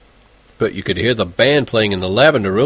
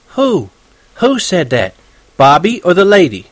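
little sister's eager question; 6 semitones higher, 3 dB louder than usual. Acted. Little sister interrupting to ask.

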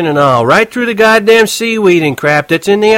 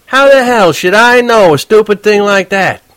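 louder, a bit less sharp; sore cabbie; 10 semitones higher, 6 dB louder than usual. Acted. Horwitz scoffing at the kid's question. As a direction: keep on grumbling at the stupid question. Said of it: "He was sore about it".

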